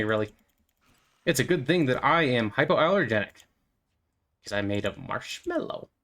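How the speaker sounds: ordinary talk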